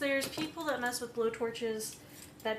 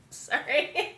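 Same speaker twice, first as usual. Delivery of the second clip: laughing apology; 3 semitones higher, 5 dB louder than usual. Real, not acted. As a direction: apologize through giggles. Laughing at own bit.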